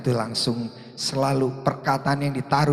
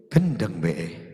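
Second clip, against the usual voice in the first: muttering bystander; 5 semitones lower, much slower than usual. Retold or imitated.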